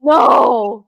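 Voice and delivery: shocked no. Real, not acted. Shocked disbelief at the medium.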